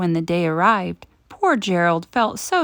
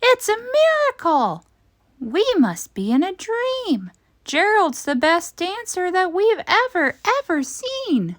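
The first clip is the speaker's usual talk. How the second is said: very high astonished crowd cry; amazed shout; 10 semitones higher than usual. A narrator's reading. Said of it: "They shouted".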